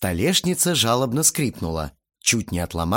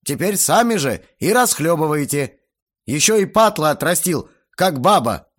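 raised, high-pitched tirade; scornful rant; 7 semitones higher, 5 dB louder than usual. A narrator's reading. Guest complains of a spoiled long-haired boy, scornful. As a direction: berate father and son with scorn.

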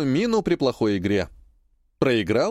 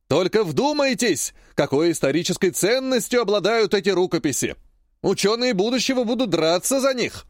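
hyping auctioneer; 7 semitones higher than usual. Acted. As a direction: whip the crowd into bidding. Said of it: "заливает вовсю Богучаров".

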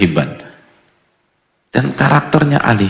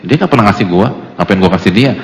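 louder, huffy Jakarta slang retort; mock indignant reply; 7 dB louder, faster than usual. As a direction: bristle back defensively. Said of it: "Kenapa Mas nggak kasih". (jawaban ketus orang pelit, lebih keras)

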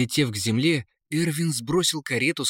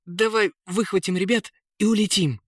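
high-pitched prince's voice; eager prince; 9 semitones higher than usual. A narrator's reading. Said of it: "предложил принц". (высокий голос принца)

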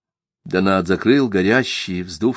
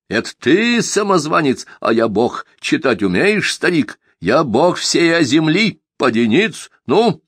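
mad self-proclaimed god; 7 semitones higher than usual. Acted. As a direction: demand worship, rising to a shout. Simagin proclaims himself god.

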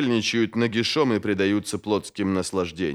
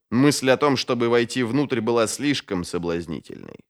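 ordinary delivery

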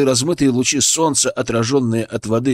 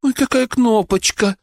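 very high, doting coo; high-pitched adoring baby talk; cooing over a baby; 11 semitones higher than usual. A narrator's reading. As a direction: gush over a tiny child.

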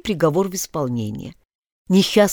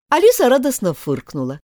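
ordinary delivery, nothing marked

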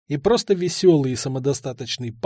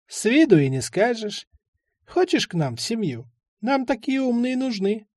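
put-on higher old man's voice, coaxing the boy; sly old man; 6 semitones higher than usual. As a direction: tempt the boy to join like a cunning old man.

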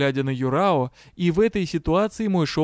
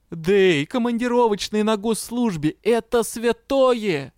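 fervent insistence; 6 semitones higher than usual. A narrator's reading. Money-minded partner insists travel pay is sacred, fervent. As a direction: stretch the last words with reverence.

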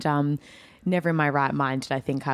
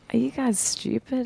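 high-pitched teasing disbelief; mock incredulous; 9 semitones higher than usual. Performer answering fans who thought she was Russian, mock incredulous. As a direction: ask it high, in playful disbelief. Acting out reply to audience members.

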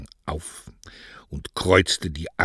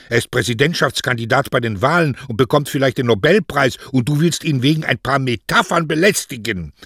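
put-on high, loud, incredulous young woman; indignant daughter, protesting; 7 semitones higher, 7 dB louder than usual. Daughter objects to bothering the famous poet, indignantly. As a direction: protest at the absurdity, voice raised.

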